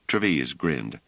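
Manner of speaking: ordinary delivery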